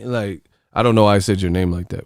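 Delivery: usual speaking voice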